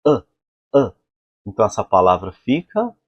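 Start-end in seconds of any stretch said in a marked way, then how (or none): 0.1-0.9 short A from the back of the throat; the English vowel of love; throaty short A. Shown as a demonstration. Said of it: "vem do fundo da garganta".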